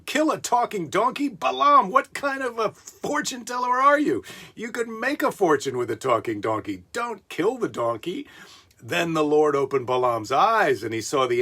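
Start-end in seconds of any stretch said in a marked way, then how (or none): 0.0-8.2 high, indignant donkey voice; the donkey scolds its master, pitched up; sassy talking donkey. Acted. Donkey retorts to Balaam.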